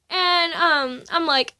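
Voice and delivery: ordinary delivery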